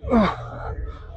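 high breathy gasp after lifting; strained exhale. Real, not acted. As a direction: let the breath burst out after the effort. Finishing a hard set.

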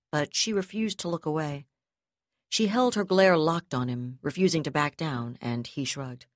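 ordinary delivery

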